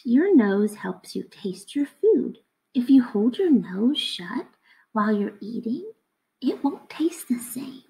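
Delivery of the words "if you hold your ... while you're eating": normal talking voice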